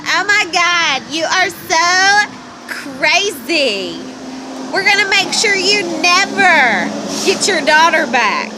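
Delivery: high, loud, drawn-out mock outrage; mocking judgmental people. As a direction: exaggerate their scandalized scolding, slowly. Mocking judgmental locals' reaction.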